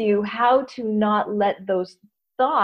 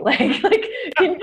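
laughing; 7 semitones higher, slower than usual. Real, not acted. Laughing mid-sentence.

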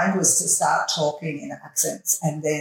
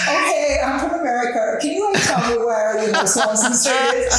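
high-pitched put-on American accent; 6 semitones higher than usual. Pretending to be a lost American asking a passer-by, high American accent. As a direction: play a lost American tourist. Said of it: "put on my American accent".

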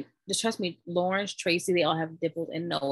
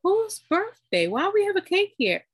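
confused drugged self; 13 semitones higher, 4 dB louder than usual. Retold or imitated. New mother puzzled by the cake, dazed and high. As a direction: ask it dazed and bewildered. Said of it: "I was like".

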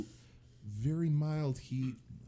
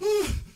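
gasp; 8 dB louder than usual. Real, not acted. Gasping at hot sauce.

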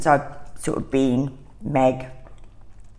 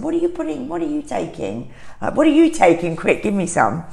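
excited; 10 semitones higher than usual. Retold or imitated. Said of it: "I said".

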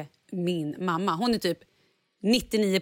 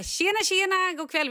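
much higher, cheerful conductor greeting; glad konduktör; 11 semitones higher than usual. Retold or imitated. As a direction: chirp it like a jolly conductor. (konduktören hälsar glatt, mycket högre)

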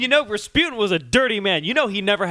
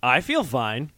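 deadpan I feel fine; 7 semitones lower, slower than usual. Shown as a demonstration. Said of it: "If I drank this".

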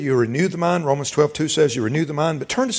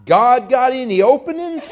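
much higher, slow, laughing question; eager young man; 9 semitones higher, 5 dB louder, slower than usual. Retold or imitated. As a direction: ask hopefully, half joking. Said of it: "He said".

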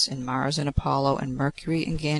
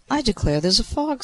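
high, lilting surprise; surprised remark; 8 semitones higher than usual. A narrator's reading. Venus to the gods as fog descends, surprised. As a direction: declare it in a much higher pitch, surprised.

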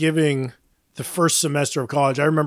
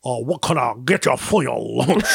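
put-on New Orleans accent; 5 semitones lower than usual. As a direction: imitate a New Orleans waiter's accent, lower than usual. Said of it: "but he was like".

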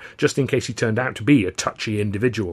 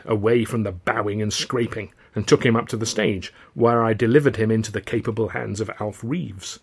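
ordinary delivery, nothing marked

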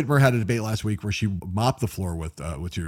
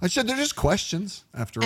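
mock innocence; 9 semitones higher than usual. Mocking the candidate's excuse.

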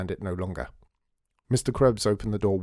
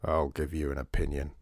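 lower, menacing threat; low and threatening; 6 semitones lower than usual. A narrator's reading. Krebs threatening the visitors at his door, low and menacing. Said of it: "said Mister Krebs menacingly".

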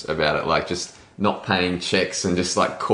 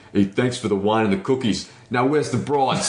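put-on brash American accent, slowed, last line higher; imitating Mike Demetrio; 5 semitones higher than usual. Mike addresses the Australian reception, American accent. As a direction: ham up a crass American toast. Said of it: "where Mike got up and said".

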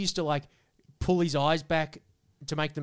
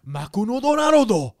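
loud, high mock-Japanese voice; hammed-up Japanese accent, punched out; Japanese accent impression; 6 semitones higher, 9 dB louder than usual. Showing off at school, hammed-up Japanese accent. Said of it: "I would do that accent".

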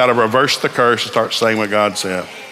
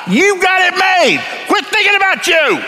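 roaring preacher peak; preaching shout; slower than usual. Real, not acted. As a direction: shout it to the whole church.

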